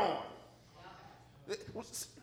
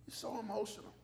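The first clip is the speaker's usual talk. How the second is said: quiet retold reply; 12 dB quieter than usual. Preacher voices reply to callers.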